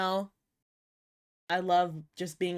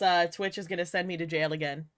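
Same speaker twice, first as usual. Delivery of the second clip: ordinary delivery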